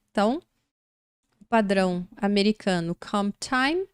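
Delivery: usual speaking voice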